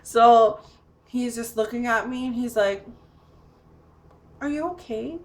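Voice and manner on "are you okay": lightly acted concern, higher and softer; imitated tentative question, high and soft; retold concerned visitor. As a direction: check on someone carefully, pitched up. Visitor checks on locked-out woman.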